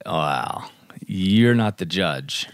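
normal talking voice

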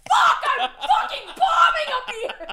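high, laughing mock despair; bombing comedian. Retelling the Hacks comic bombing, laughing mock despair. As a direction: ham up a comic dying onstage.

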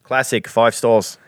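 slow, drawn-out Aussie read; mock Australian accent. Host finishing an Australian fan's review, mock Australian accent. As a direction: savor the review in a jokey Australian voice.

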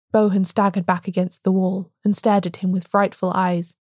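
ordinary delivery

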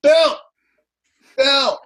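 loud, high call across a crowd. Retold or imitated. A fan in the audience yelling for Bill, loud high call. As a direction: shout his name from the back of the room. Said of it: "there's this guy going".

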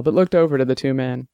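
usual speaking voice

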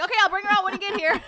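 fast, high and loud through laughter; real laugh spilling into the words. Real, not acted. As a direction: blurt it out while cracking up. Laughing while promising to bring Lisa.

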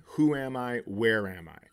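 usual speaking voice

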